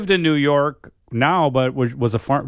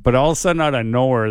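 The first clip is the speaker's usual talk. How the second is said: normal talking voice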